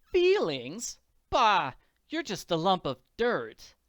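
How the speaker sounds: high, loud scorn; scornful wife. Acted. Wife insulting her husband in the hallway, scornful. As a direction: scoff at your husband with contempt.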